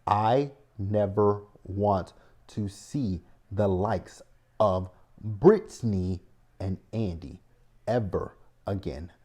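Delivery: slow, deliberate, every word weighed; slow final repeat. Real, not acted. Host repeating her ban on the couple slowly. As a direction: spell it out slowly for the last time. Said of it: "I'm gonna repeat it one last time".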